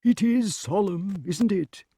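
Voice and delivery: high, drawn-out character voice; King Pellinore. A narrator's reading. Pellinore remarks on the solemn news, high character voice. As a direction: pitch it high and slow.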